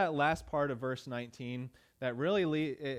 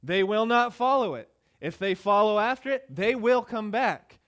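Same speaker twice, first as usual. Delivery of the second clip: loud, raised preaching voice, words driven hard; forceful preaching; 5 semitones higher, 7 dB louder than usual. Acted. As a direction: pastor pressing the point on the congregation, loud preaching.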